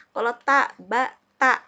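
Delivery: ta and ba said apart, loud and clear; teacher contrasting letter sounds. Shown as a demonstration. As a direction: sound out ta, ba, ta for the class. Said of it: "beda ya".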